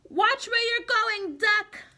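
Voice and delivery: fussy chicken. Acted. Chicken scolds Duck in a high hen voice.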